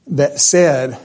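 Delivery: ordinary delivery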